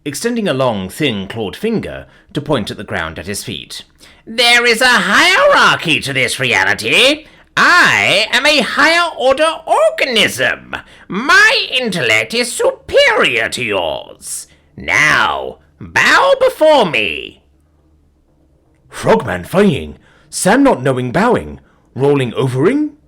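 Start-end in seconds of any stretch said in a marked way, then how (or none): 4.2-17.4 high, loud, slow proclamation; imperious alien villain. A narrator's reading. Alien imperiously commanding the dog. 18.9-23.0 loud, happy doggy voice; cheerful dog answering a command; friendly talking dog. A narrator's reading. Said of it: "Bob's the friendliest dog I've ever met".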